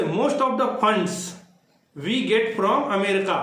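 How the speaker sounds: slow dictation pace; slow example reading. Shown as a demonstration. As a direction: read the sentence out slowly, word by word. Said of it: "देखो जो we get from America है".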